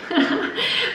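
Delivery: high laugh. Real, not acted. Laugh after retold no.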